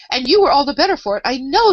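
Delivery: high, loud note of triumph; triumphant. A narrator's reading. The Queen scoring a point on Alice, triumphant. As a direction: crow over winning the point. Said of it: "the Queen said triumphantly".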